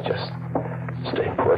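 breathless whisper to himself. Acted. Hiding man in the cellar tells himself, whispering. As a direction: hush it so no one hears.

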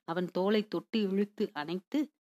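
usual speaking voice

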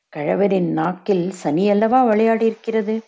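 ordinary delivery

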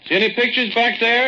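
loud, high call into the dark. Acted. Friend shouting to Lucille far back in the cave, loud call.

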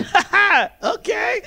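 high, sing-out fake chuckle; mock laughing reply. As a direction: imitate an agent laughing, then agreeing. Airline agent's laughing reply acted.

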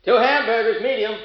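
high, carrying counterman's call; calling an order. Acted. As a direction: sing out the order to the kitchen.

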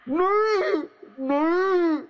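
high, panicked cry of terror; terrified cry. Acted. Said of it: "विनय की आंखें दहशत में".

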